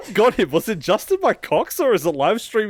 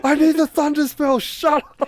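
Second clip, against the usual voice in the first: high mock shout; 13 semitones higher, 6 dB louder than usual. Acting out shouting.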